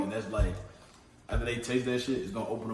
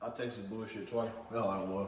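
chewing while talking; mouth full; 4 semitones lower, 10 dB quieter than usual. Real, not acted. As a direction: talk through a mouthful. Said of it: "You still chewing".

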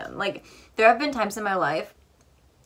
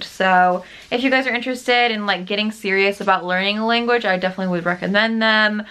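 upbeat sponsor pitch, a little louder; ad read; 6 dB louder than usual. Acted. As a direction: sell it like a friendly ad.

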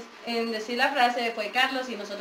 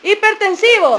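loud; 7 semitones higher, 11 dB louder than usual. Real, not acted. Shouting the guess.

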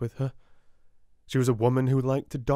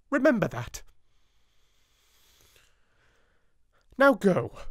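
much higher, louder cold orders; threatening headmistress; 6 semitones higher, 3 dB louder than usual. A narrator's reading. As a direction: dismiss her with a threat. Miss Minchin threatening Sarah.

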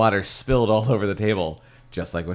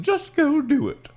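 high, impatient mom voice; impatient mother, pitched way up. A narrator's reading. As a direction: snap it out like a fed-up mom. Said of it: "my mom said impatiently".

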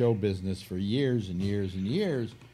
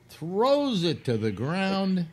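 ordinary talk, nothing marked

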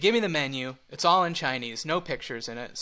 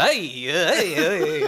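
mock Yiddish "hey, hey"; hammed-up Yiddish lilt on each "hey"; Yiddish intonation; slower than usual. Shown as a demonstration. Correcting the wrong Italian sound, mock Yiddish accent. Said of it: "that's Yiddish".

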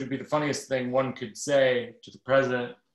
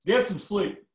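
higher, louder Obama impression; hammed-up president with a pause; mock Obama giving advice; 7 semitones higher, 3 dB louder, much slower than usual. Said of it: "And Obama says".

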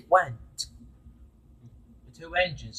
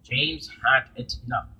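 usual speaking voice

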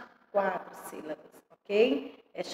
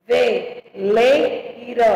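very slow "Veleiro", ve-lei-ro in separate beats; syllable by syllable; 4 dB louder, much slower than usual. Shown as a demonstration. Teacher separating words for pupils, syllable by syllable. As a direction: count out each syllable of "Veleiro". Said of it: "separação de palavras e sílabas".

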